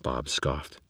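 ordinary delivery

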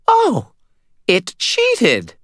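high, loud outraged cry; outraged shriek. A narrator's reading. Vampire learning her creature was cheated, high outraged shriek. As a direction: shriek it in betrayed fury. Vampire enraged, fangs bared.

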